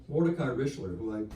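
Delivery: ordinary talk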